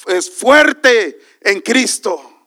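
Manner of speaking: loud, high proclamation, drawn out; exultant preaching shout. Real, not acted. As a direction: shout praise out to the whole church. Preacher exclaims praise to congregation.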